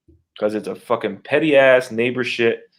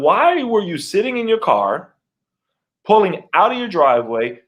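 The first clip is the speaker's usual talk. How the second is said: higher, drawn-out questioning; mildly indignant, pitched up; retold exasperated challenge; 8 semitones higher than usual. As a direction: press for an explanation.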